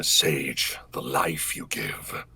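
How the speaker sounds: low, soft, slow whispery menace; Omen voice. Acted. Voice actor reciting his character's line on stream, low quiet menace. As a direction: let it drift out cold and quiet. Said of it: "recognize most of the lines".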